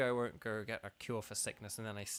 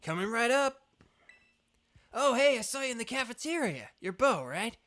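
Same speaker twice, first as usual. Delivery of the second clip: high, loud opening, bouncy energetic character; fiery, eager cook; 7 semitones higher, 5 dB louder than usual. Acted. Crispin greeting the challenger Bo, fired-up and eager. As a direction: play an upbeat hot-blooded challenger. Voices Crispin from game text.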